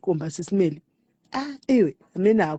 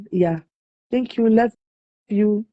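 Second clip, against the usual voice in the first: ordinary talk, nothing marked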